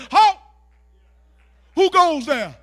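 loud, high guard's challenge; challenging sentry. Acted. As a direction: shout it like a guard stopping an intruder. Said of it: "the peace of God says".

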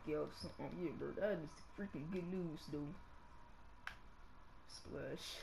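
ordinary talk, nothing marked

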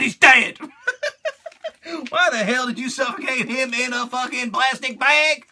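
normal talking voice

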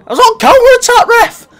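high, loud pleading shout at the referee; mock appeal to ref. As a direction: yell it up high like a wronged player.

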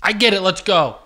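loud, high impatient burst; yelling. Real, not acted. Partner urging the reader to get on, yelling. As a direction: cut in loudly to hurry them along. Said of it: "Don't yell at me".

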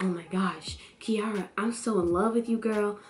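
soft lovestruck confession, imitated; mock-smitten, softened voice; Pope. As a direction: confess love in a hushed mock-earnest voice. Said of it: "'Cause here go Pope being like".

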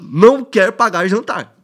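loud, slow headline read; slow, emphatic title announcement. Acted. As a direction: punch out each word like a headline. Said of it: "ó o título que vem".

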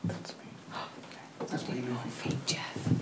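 hushed whisper; breathy low whisper. Real, not acted. Whispered warning during ghost hunt.

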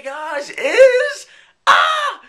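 a sudden high excited shriek; hyped shriek. Acted. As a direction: squeal the reveal like a game show host. Hyped announcement of segment.

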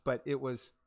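ordinary talk

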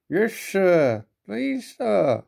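high, nervous small voice. A narrator's reading. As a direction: answer nervously, pitch jumping. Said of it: "said Percy nervously".

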